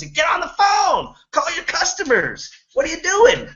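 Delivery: high shrill yelling boss; screaming. Retold or imitated. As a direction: yell it at the sales floor. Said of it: "he would scream at us".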